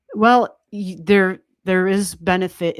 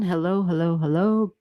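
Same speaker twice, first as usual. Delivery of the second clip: ordinary delivery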